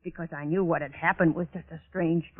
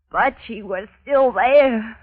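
slow, raised and loud; heightened insistence; 5 semitones higher, 8 dB louder, slower than usual. Acted. Recalling the body still in the well, intensely insistent. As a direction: draw it out with rising intensity.

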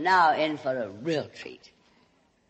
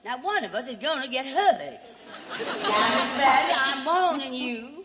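mock struggle; 7 semitones higher, 3 dB louder than usual. Fights off groping producer.

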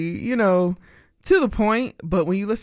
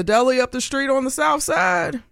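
shouted shout-out; 8 semitones higher than usual. Real, not acted. Shouting out the neighbourhood.

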